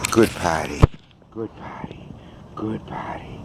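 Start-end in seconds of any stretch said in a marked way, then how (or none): 1.4-3.4 hushed breathy praise for the dog; whispered praise. Real, not acted. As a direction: whisper so as not to disturb. Quiet praise while dog pees.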